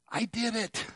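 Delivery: put-on high, soft child's voice, lightly done; a small kid proud of himself; proud little boy. Grandson at the top of the wall, high soft proud voice. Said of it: "he turned around and said".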